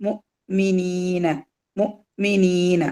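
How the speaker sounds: slow മുഅ്മിനീന with a catch after mu; മുഅ്മിനീന, cut after mu, long ee; sounded hamza. Shown as a demonstration. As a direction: stop the throat briefly in മുഅ്മിനീന. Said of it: "ഒരു ഹംസത്തുൽ ഖത്തയുണ്ട്".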